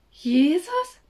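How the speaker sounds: höher, lauter, fragend verwundert; ungläubig nachfragen; verdutzt. Acted. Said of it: "sagte Mia verdutzt". (Mia fragt Samu verdutzt, steigender Ton)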